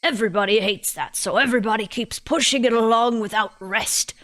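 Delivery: put-on British old lady explaining; old woman's lecture with a British lilt; old person, British. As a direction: voice a British granny holding forth. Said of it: "all my old people voices turn British".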